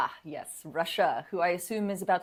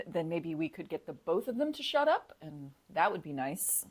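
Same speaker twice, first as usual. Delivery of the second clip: ordinary talk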